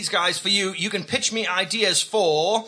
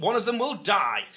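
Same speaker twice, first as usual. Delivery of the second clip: ordinary talk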